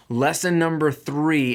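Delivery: a title card read aloud, punching Lesson; announcing a heading. Real, not acted.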